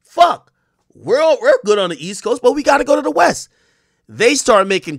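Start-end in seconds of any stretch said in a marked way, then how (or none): 0.0-3.5 lightly acted exclamation, higher and louder. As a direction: quote him with a raised, urgent pitch. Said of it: "you get [name] who says".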